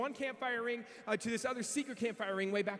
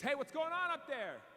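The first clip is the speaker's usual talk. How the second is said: high, drawn-out call up the line; slow, raised-pitch shout into the distance; calling ahead; 6 semitones higher than usual. Retold or imitated. Impatient camper yells up the line.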